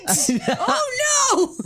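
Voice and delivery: high, half-laughing cry of horror; hammed-up panicked realization; mock dismay. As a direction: wail it in comic disbelief, rising to a shout. Acts out sudden sinking realization.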